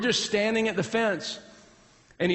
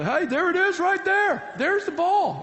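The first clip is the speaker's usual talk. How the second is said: high, drawn-out holler at the fielders; calling out; 13 semitones higher, 3 dB louder, slower than usual. Acted. Coach to fielders who miss the ball, high urgent calling. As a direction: holler it like pointing out a missed ball.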